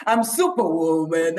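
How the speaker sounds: low-pitched heroic announcement; Superwoman. Shown as a demonstration. Superhero comes into a room, announcing herself grandly. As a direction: announce yourself as a mighty superhero. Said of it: "when a superhero comes into a room".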